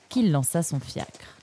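usual speaking voice

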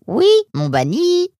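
very high, slow squawky bird reply; high-pitched parrot squawk. A narrator's reading. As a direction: answer like a chirpy parrot. Parrot answers Nemrod.

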